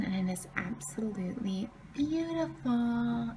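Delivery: soft hushed whisper. Real, not acted. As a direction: breathe the words in a whisper. Snow morning, baby sleeping.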